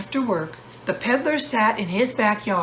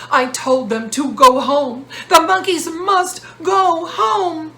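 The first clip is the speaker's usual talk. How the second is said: loud, high shout, slowing at the end; angry peddler shouting about the monkeys; 7 semitones higher, 6 dB louder than usual. Acted. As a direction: stamp and yell it out. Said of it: "he shouted".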